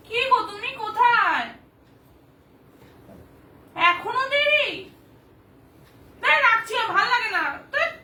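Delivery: high, loud, fed-up complaint; annoyed. Acted. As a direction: scold a late husband over the phone. Annoyed phone call to late husband.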